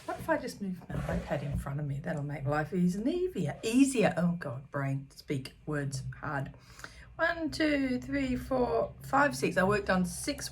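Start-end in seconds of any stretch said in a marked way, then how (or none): none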